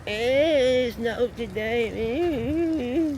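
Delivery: high, nasal mock complaint; mock the nitpicking commenters, high and whiny. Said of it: "people in the comments going".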